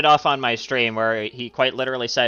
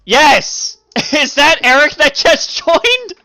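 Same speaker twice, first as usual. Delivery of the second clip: excited yell; 14 semitones higher, 11 dB louder, much slower than usual. Real, not acted. Excited shout at newcomer joining.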